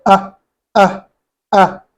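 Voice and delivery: "Ah, ah, ah" each cut off by a sharp catch in the throat; glottal stop demo. Shown as a demonstration. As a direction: stop the air hard at the end of "Ah". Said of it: "Hamza has to make a stop here".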